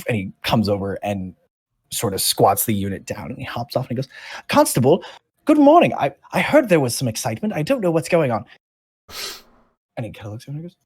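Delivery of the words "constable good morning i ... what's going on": high, loud gnome greeting, then quicker. Acted. Gnome greets the constable, high and eager. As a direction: chirp it out like an eager little gnome.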